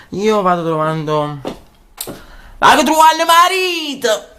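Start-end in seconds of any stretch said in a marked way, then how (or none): 2.6-4.3 zia Wilma voice. Acted. Zia Wilma seeking husband.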